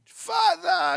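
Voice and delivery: high, loud call of Father!; a boy yelling for his dad; crying out. Retold or imitated. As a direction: son running home crying out for his father. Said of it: "he cried".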